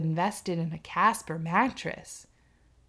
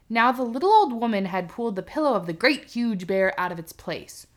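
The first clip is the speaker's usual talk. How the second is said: normal talking voice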